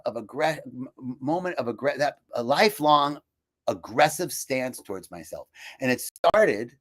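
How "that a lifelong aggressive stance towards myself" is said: normal talking voice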